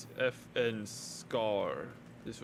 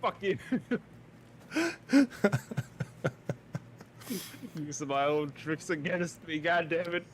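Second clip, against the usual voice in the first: laughing mock outrage, pitched up; laughing protest; 7 semitones higher, 3 dB louder than usual. Real, not acted. Friend turns his own trick back, laughing protest. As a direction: yelp it through the laughter.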